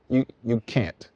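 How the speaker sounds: ordinary talk, nothing marked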